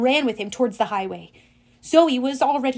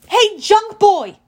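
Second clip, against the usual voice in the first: high, loud, drawn-out call; slow, loud taunt; taunting yell; 9 semitones higher, 8 dB louder, slower than usual. A narrator's reading. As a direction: yell to get a giant's attention. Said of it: "I yelled".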